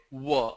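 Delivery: W as "wuh", lips rounded; W sound. Shown as a demonstration. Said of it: "your lip rounded".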